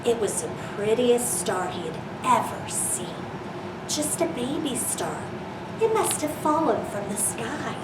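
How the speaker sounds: gentle storybook reading voice; reading to children. Acted. Reads picture book to children.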